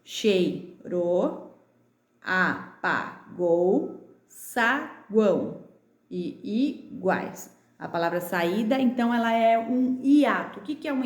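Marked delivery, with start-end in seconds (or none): 0.0-7.5 slow chei-ro, a-pa-gou, sa-guão, i-guais; teacher recapping words in slow beats; syllable by syllable. Shown as a demonstration. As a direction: clap out each word, gaps between syllables. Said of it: "aquelas que eu bati com vocês".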